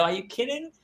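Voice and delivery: high, soft incredulous question; surprised and softly reassuring; gentle disbelief. Retold or imitated. Dad softly surprised at his daughter's worry. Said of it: "And I go".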